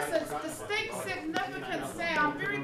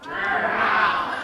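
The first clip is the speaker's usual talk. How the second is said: roar; 7 semitones lower, 9 dB louder than usual. Real, not acted. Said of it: "sounds like a really sick tiger".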